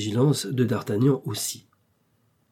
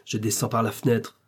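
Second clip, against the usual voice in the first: ordinary delivery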